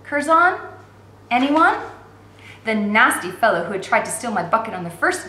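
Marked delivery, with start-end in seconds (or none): none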